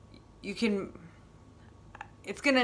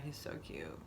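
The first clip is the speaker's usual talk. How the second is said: soft gush; 13 semitones lower, 16 dB quieter than usual. Real, not acted. Gushing over a cute dog.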